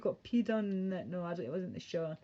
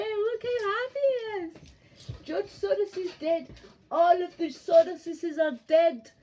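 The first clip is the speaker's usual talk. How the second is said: high, loud excited dog voice; squealing dog talk; 10 semitones higher, 6 dB louder than usual. Real, not acted. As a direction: shriek at the dog with joy.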